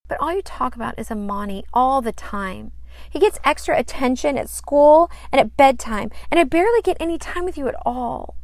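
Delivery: put-on high, loud, fast childish pout; a daughter pouting to her dad about attention. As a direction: complain fast and high like a sulking girl. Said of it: "pouted Sandy".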